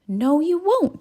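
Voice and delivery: high, loud scolding no; indignant hen. A narrator's reading. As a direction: shut them down sharply. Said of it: "said the little red hen".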